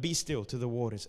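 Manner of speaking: low, soft and unhurried; calm command. Retold or imitated. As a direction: still the waters with quiet authority. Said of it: "he's cool in every circumstance".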